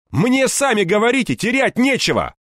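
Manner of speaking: high, forceful threat; defiant shouting. Acted. Hero threatening thugs over a fuel barrel, defiant shout. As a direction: call it out with reckless bravado.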